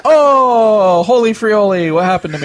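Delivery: hammed-up high, loud "oh" easing down; mock astonishment. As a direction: play a startled movie character, starting big and settling. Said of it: "he's like".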